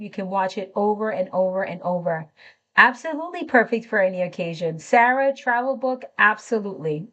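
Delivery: usual speaking voice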